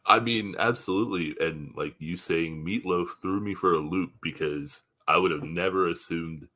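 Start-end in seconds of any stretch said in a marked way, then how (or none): none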